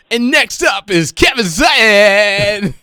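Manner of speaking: slow, loud announcer call; announcer intro. Pretend. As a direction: boom it out like a ring announcer. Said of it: "I just need him to, like".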